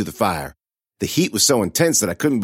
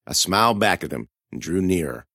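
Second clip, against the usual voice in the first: plain reading voice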